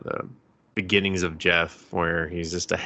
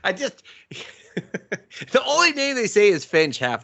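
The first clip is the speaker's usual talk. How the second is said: laughing; 12 semitones higher than usual. Real, not acted. Laughing complaint.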